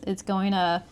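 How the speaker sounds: ordinary talk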